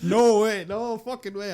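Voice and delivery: loud amused refusal to a co-host; emphatic refusal. Real, not acted. Emphatic refusal after joke.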